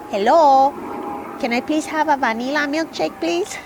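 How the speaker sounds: high, loud, exaggerated Spanish accent; funny Spanish accent. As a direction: ham up a mom's Spanish accent. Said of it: "in her funny Spanish accent".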